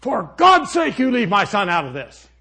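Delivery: mock shriek of a debater defending her son; furious outburst. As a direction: imitate her exploding at him. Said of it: "and she said".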